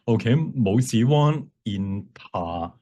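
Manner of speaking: normal talking voice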